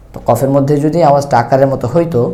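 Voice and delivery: ordinary talk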